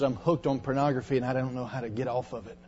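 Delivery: hushed confession. Retold or imitated. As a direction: rush it out in a low voice. Boy confesses to preacher.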